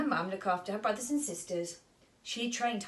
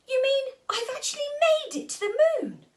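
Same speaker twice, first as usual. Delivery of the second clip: much higher, louder, fast squeal; ecstatic cry; 7 dB louder, faster than usual. Acted. Molly realises she reached the moon, squealing with joy. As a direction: burst out in joyful disbelief.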